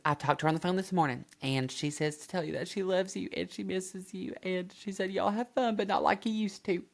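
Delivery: high-pitched put-on voice; imitated Shelly. Shelly telling Anna about Mom, high imitated voice. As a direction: relay the message in a high imitated voice. Voicing Shelly relaying Mom's message.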